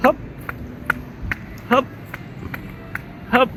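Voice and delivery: panting hops mid-jog; calling out hops on the run; while running. Real, not acted. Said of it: "kita coba aja sambil lari".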